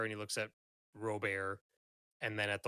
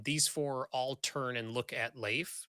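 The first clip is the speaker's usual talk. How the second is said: ordinary talk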